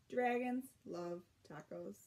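usual speaking voice

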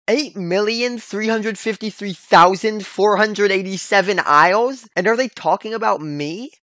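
loud, high disbelieving repeat; incredulous. Acted. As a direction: shout the number back in shock. Stunned repeat of absurd number.

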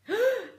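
high, loud startled gasp; scared gasp. Acted. Bunce startled by the order, loud gasp. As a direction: gasp in fright. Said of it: "screamed Bunce".

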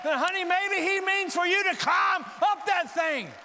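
high, loud, driving altar call; full-force preaching. Acted. Preacher calling congregation to stand and pray, full-force preaching.

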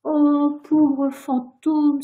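high, drawn-out cry of sympathy; pitying exclamation. A narrator's reading. Virginia hearing the ghost starved, pitying exclamation. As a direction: exclaim with slow, swelling pity. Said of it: "s'écria Virginia".

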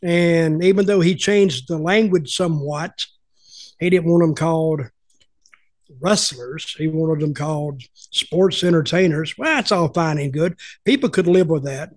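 ordinary delivery

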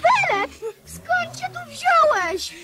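put-on a cry of delight at seeing an old friend; overjoyed surprise. King meeting long-lost friend Felek, tearful joy. Maciuś meets Felek again.

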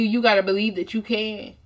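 normal talking voice

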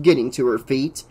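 ordinary delivery, nothing marked

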